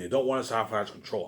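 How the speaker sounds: ordinary talk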